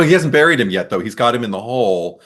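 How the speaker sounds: ordinary delivery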